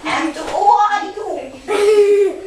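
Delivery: very slow croaky frog voice. Shown as a demonstration. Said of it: "Лягушачьим голосом". (медленный лягушачий голос)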